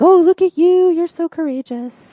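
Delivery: high, loud coo that eases off; gushing admiration. Retold or imitated. Two friends admire a turtle holding his breath, gushing. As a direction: gush over a brave little pet.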